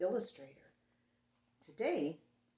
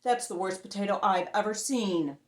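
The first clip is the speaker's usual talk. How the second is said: monster voice; 8 dB louder than usual. Acted.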